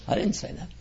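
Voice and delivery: conspiratorial whisper; whispered aside. Real, not acted. Speaker repeating his Bush joke to the crowd, whispered. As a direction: breathe it as a secret denial.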